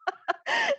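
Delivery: high short burst of laughter; brief high-pitched chuckle. Real, not acted. Moderator laughs at guest laughing.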